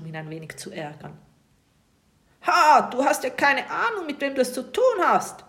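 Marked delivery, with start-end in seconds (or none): 2.4-5.4 viel höher und lauter gerufen; empörter Ruf. A narrator's reading. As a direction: beleidigt aufbrausen. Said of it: "ruft der Pirat dann auch sofort". (Pirat ruft gekränkt und laut das Kind an)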